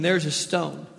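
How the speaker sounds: ordinary talk, nothing marked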